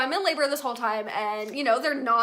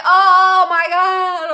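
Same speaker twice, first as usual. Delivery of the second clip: loud, drawn-out wail of pain, retold; freaking out; 7 semitones higher, 15 dB louder, much slower than usual. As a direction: cry out long and high, reenacting the panic. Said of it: "I'm, like, freaking out over here".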